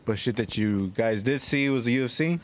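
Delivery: usual speaking voice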